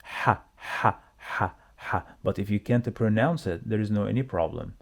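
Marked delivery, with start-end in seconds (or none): 0.0-2.0 "ha" with a strong throat-made Arabic ح, not English H; Arabic ḥa sound. Shown as a demonstration. Teacher demonstrating the Arabic letter to learners, "ha" as throaty ح. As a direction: repeat "ha" singly, pushing the h from the throat. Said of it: "the sound here is not H".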